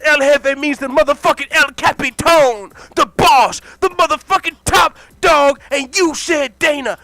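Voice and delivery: raging shout. Acted. Dana shouting at Scott about being called El Jefe. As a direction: bellow your own title at him. Dana explodes over the name.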